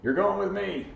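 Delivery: high, loud mock dad announcing the plan; bossy dad. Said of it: "your dad goes".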